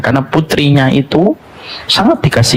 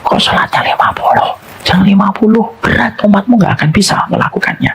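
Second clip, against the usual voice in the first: urgent whisper; 10 semitones higher than usual. Acted. Musa pleading with the Prophet.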